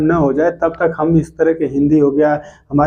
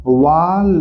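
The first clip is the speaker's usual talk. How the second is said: syllable spelling; much slower than usual. Shown as a demonstration. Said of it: "तो वाल".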